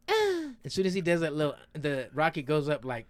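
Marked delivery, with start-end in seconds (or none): 0.1-0.5 short high-pitched grunt; kid's grunt. Retold or imitated. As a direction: squeak out a small strained grunt. Imitates the rocket kid's grunt.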